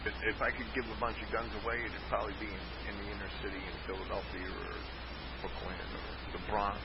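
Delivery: distant, muffled, far from the mic; from another room. Real, not acted. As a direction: voice coming from the next room. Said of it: "you sound like you're in the next room".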